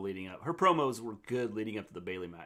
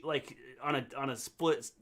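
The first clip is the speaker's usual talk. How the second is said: ordinary talk, nothing marked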